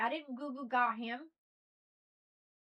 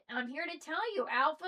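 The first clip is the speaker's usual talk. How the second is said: normal talking voice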